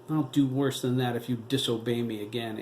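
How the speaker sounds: ordinary delivery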